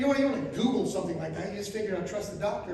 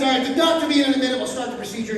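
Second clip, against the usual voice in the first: fast, louder routine announcement; lightly voiced hurried nurse; brisk nurse; 3 semitones higher, 6 dB louder, much faster than usual. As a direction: rattle it off like a bored nurse. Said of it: "So she says".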